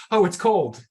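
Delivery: high, loud startled yelp of a quote; mock cold shock. Dipping a toe in cold water, startled high yelp.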